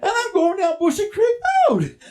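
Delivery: high, rising panicked cry; comic panic. Acted. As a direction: wail it as the dread dawns. Panicked realisation in the chase.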